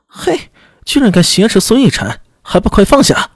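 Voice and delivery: high, sharp guard's shout; angry shout. Acted. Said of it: "纷纷斥喝着跳开". (厉声呵斥)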